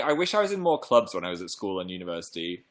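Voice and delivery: ordinary talk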